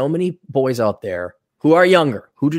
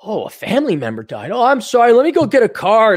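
higher and louder, eager; mock nice guy; 7 semitones higher, 5 dB louder than usual. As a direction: rush to help like a sucker. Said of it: "if you were younger or stupider".

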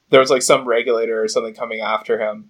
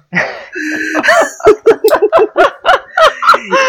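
loud high laugh; 6 dB louder than usual. Real, not acted. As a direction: burst of high-pitched laughter. Laughing at the Fuckerberg joke.

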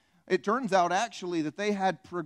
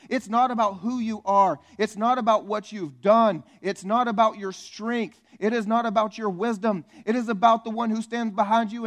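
full-force preaching; 4 semitones higher, 6 dB louder than usual. Acted. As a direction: proclaim it boldly to the congregation.

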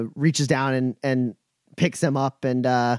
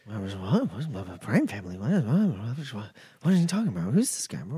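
confused surprise; 2 semitones lower, 5 dB quieter, faster than usual. Real, not acted. Confused reaction to stranger.

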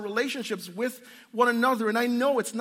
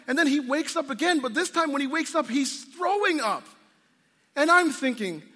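normal talking voice

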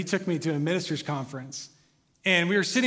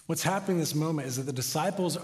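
ordinary talk, nothing marked

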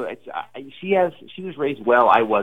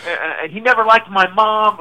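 mocking ex-wife; 11 semitones higher than usual. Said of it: "It's like".

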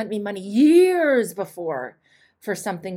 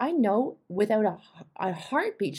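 normal talking voice

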